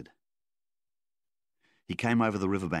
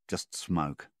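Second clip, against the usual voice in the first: plain reading voice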